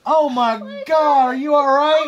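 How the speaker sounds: hammed-up shock; mock alarm. Grandpa fakes alarm over head bump.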